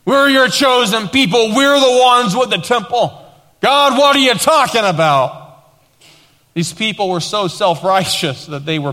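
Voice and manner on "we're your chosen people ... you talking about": high, raised mock-indignant retort; indignant protest. Self-righteous people answering God back, mock indignation.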